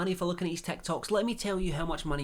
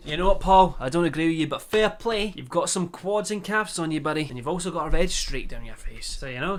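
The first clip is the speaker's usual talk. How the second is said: ordinary talk, nothing marked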